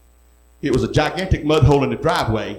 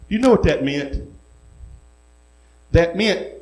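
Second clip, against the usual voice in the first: normal talking voice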